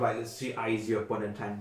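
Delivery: normal talking voice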